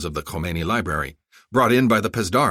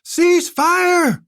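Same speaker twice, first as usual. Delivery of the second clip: much higher, louder "Cease fire!"; deafened bellow; 9 dB louder than usual. Acted. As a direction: bellow it over ringing ears. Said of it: "Zolkadar shouted".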